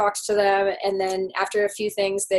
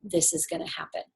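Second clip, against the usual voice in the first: softer, breathy, to herself; inner voice; 5 semitones lower, 8 dB quieter than usual. Retold or imitated. Traveller silently telling herself, soft and unvoiced. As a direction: murmur it like a private vow. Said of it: "in my head".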